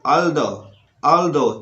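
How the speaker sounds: although said with a firm dental d sound; model pronunciation. Shown as a demonstration. Teacher modelling although for the class. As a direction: say although clearly for students to copy. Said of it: "इस तरह की उच्चारण आपको करना है".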